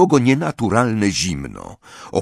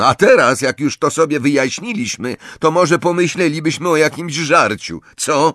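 higher, exasperated push; angry; 7 semitones higher, 4 dB louder than usual. A narrator's reading. Hungry Ron asks about food, angry. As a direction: demand crossly, louder at the start. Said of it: "powiedział ze złością Ron".

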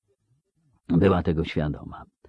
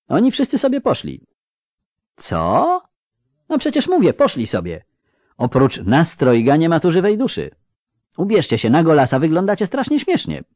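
put-on much higher, excited girlish voice; young girl; 9 semitones higher than usual. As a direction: chatter like a cheeky teenage girl. Dziecięcy głos Ciri. (Ciri, wysoko i z przejęciem)

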